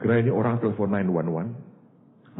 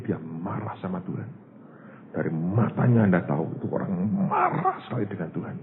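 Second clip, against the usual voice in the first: ordinary talk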